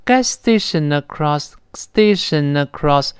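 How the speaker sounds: gas station across, said slowly with station and across run together; station across, the n gliding straight into a; linking demo. Shown as a demonstration. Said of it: "自然连到一块儿".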